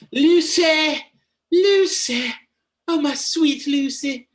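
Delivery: loud, high mock-swooning cry; Winona Ryder. Mocking a bad film performance, hammed-up high cry. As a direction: call out in a hammed-up, high-pitched impression. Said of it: "Winona Ryder's bad with her".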